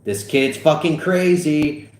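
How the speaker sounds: slow, high announcement; drawn-out show of alarm for a crowd; mock-serious. A narrator's reading. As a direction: play it as seriously as possible. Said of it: "as seriously as he possibly could".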